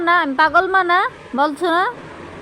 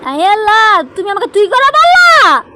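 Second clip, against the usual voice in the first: higher and louder, shocked scolding; mock outrage; 5 semitones higher, 9 dB louder than usual. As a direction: play the outraged mum. Mother scolds child for rudeness.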